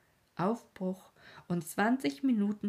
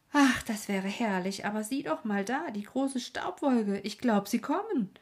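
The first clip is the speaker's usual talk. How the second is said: higher and louder, eager; excited boy; 4 semitones higher, 5 dB louder than usual. Acted. Boy spotting the dust cloud calls to his uncle, higher and eager. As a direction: point and cry out in excitement.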